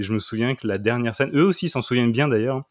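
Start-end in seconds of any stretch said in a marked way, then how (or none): none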